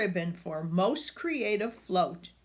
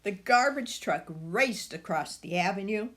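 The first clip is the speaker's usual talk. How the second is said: usual speaking voice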